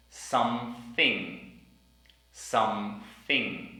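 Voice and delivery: Something said slowly, th in the middle blown; Something, drawn out, th clear; slow careful something. Shown as a demonstration. Teacher modelling Something slowly. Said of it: "words with the T H sound in the middle".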